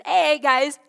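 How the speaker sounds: calling out loudly across the water; loud cheerful greeting to the disciples on the boat; loud friendly call. Acted. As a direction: hail them loudly, like an old friend. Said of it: "And he says".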